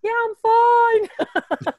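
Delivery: high, loud, slow teary whine; mock a tearful, stubborn grown child; imitating crying self. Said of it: "I'm just crying".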